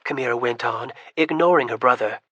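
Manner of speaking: ordinary delivery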